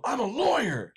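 high, loud mock line reading; Eli Roth's line. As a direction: belt it out like a hammy actor. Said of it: "I go".

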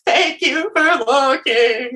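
high, slow whimper; hammed-up sobby gratitude, words stretched; mock tearful thanks. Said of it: "uncontrollably bawling my eyes out".

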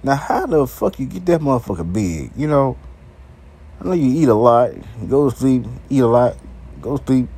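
normal talking voice

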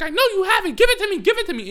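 high, loud mock screaming; melodramatic kid. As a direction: overact a child's screamed demand. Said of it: "straight up actors and actresses".

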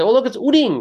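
put-on high, loud child's voice; a delighted kid pointing out a bird; little cousin. Young cousin excitedly pointing at a bird, high and loud. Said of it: "He said".

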